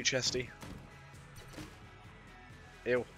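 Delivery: ordinary delivery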